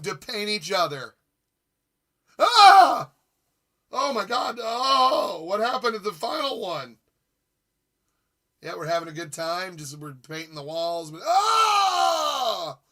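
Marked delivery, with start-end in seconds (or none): 2.4-3.1 high piercing shriek; sudden playful scream; mock scream. Scream reacting to painting. 11.2-12.8 high loud shriek; playful yell; mock scream. Scream while painting walls.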